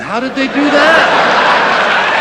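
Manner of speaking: high, loud and slow, baffled; mock bafflement; incredulous shout. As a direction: demand to know in disbelief. Incredulous question, repeated.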